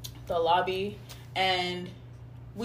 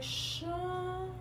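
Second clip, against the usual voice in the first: high, soft drawn-out call; lightly mimicked searching call; calling out a name; 7 semitones higher, 10 dB quieter than usual. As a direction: imitate someone calling out softly in singsong. Roommate calling out his name.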